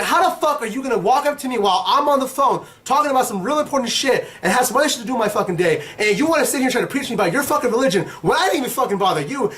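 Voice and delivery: re-enacted rant, high and relentless; going off. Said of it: "I went off of this dude".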